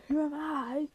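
swelling monster outcry. A narrator's reading. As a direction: build it into a drawn, booming challenge. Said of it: "its voice getting louder".

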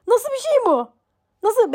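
high-pitched disbelief; amazed exclamation. Real, not acted. Vlogger marvelling at instant anaesthesia, amazed high exclamation. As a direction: marvel at it, voice shooting far up.

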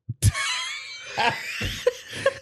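breathless, voiceless laughter; real laughing fit. Real, not acted. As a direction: crack up in a long wheeze of laughter. Cracks up at own theory.

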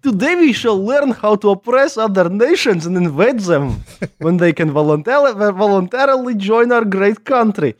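high, stern Russian drill instructor; propaganda teacher voice. Pretend. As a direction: lecture the kids like a Soviet teacher. Mock Russian teacher addressing kids.

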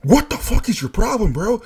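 high, loud fed-up yell; exasperated shout. Retold or imitated. [name] shouting back at raging coworker. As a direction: blow up at her in disbelief. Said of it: "And I'm like".

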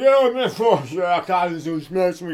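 high, loud slurry mock drunk; hammed-up drunk guy yelling; rowdy drunk dude. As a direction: whoop it up like a sloppy drunk. Imitating rowdy drunk dudes.

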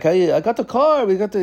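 high, exaggerated grandpa voice; foreign grandpa. Foreign grandpa announces he has the car, high put-on voice. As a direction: imitate a genie-like old relative up high. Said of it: "he's, like, foreign to their speak".

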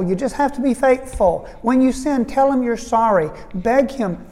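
rally the worried flock with conviction; preaching reassurance. Acted. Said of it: "And I'll say".

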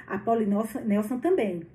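ordinary delivery

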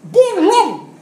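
high, loud triumphant "Bolong!"; loud excited exclaim. Retold or imitated. Preacher tells the congregation the desk broke, loud and gleeful. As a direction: announce the broken table with glee. Table breaks through.

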